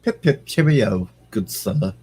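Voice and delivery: mock British accent, jolly farewell; lightly hammed British accent; bad British accent. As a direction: toss off a stock English gent's accent. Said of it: "Yep, it's Bad Accent Sunday".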